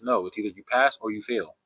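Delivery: ordinary talk, nothing marked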